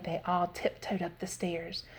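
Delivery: ordinary delivery, nothing marked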